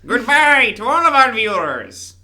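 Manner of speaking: high, loud, slow cartoon villain; big, high-pitched villain sign-off; Skeletor. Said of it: "I'll do my Skeletor voice".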